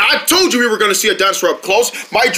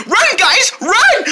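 higher shouted command to run; urgent call; 11 semitones higher, slower than usual. Acted. Deer urging friends to run, high call. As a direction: tell them to run now. Escaping dinosaur.

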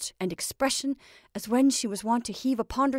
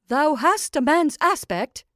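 loud, raised stern command; stern witch; 6 semitones higher, 7 dB louder than usual. A narrator's reading. Witch sternly commands her Scarecrow to speak. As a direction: address it sternly, pitched up. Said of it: "said she sternly".

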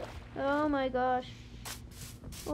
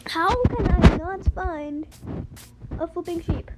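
loud, drawn-out, exasperated question; raised voice, stretching out the complaint; exasperated outburst; 3 semitones higher, 8 dB louder, slower than usual. Real, not acted. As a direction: cry out in gamer frustration. Exasperated at finding no sheep.

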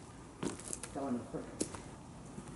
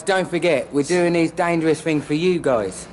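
louder, hammed-up appeal to the viewers; mock YouTuber hype; 2 semitones higher, 6 dB louder than usual. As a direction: sell the danger to the audience like a cheesy vlogger. Continuing the hype outro.

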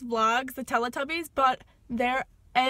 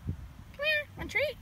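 high-pitched treat offer; much higher sweet pet voice; coaxing the dog; faster than usual. Real, not acted. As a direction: coax the puppy with a treat.

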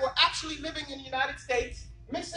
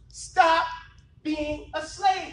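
forceful emphasis; 2 semitones higher, 6 dB louder, much slower than usual. Real, not acted. Preacher hammering his point.

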